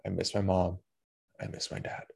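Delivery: softly voicing how he misses his parents; quiet, wistful. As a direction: let it slip out to yourself. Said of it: "said involuntarily out loud".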